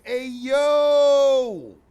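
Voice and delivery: high, very loud drawn-out holler; shocked shout. Real, not acted. Reacting to the man's face, loud shocked holler. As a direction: holler it long in disbelief.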